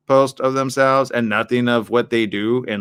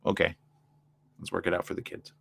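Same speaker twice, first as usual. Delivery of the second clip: soft, lower, giving in; resigned quiet wife; 6 semitones lower, 11 dB quieter than usual. Retold or imitated. Wife quietly agreeing to stay for the kids, soft and resigned. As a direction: sigh it out, letting it go for the kids. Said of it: "The lady's like".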